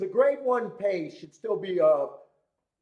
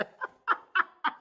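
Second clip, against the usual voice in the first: burst of laughter. Real, not acted. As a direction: crack up at your own punchline. Laughs at own comeback.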